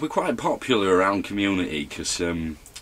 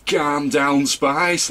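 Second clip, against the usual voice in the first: higher, louder retold yell; retold shout into a curry house, higher and louder; mock shout; 8 semitones higher, 4 dB louder, much slower than usual. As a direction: yell it in jest through a doorway. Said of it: "shouting in".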